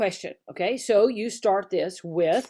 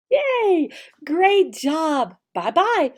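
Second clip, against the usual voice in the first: big cheer; 6 semitones higher than usual. Shown as a demonstration. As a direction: cheer the kid on, really high. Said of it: "See, simple".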